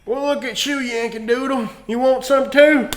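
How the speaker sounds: high-pitched mock Southern lawman; Southern cop. Southern cop turning on a Northern bystander, mock menacing officer. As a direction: taunt like a cartoon Southern cop. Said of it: "racist Southern cop".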